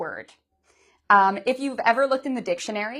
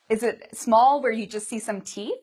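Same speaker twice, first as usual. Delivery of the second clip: usual speaking voice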